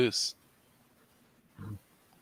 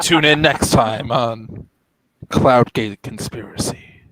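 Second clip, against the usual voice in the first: slow, loud trailer voice; mock announcer; 7 dB louder, slower than usual. Streamer closing the game as a TV announcer, slow and loud. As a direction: sell the next episode like a TV promo.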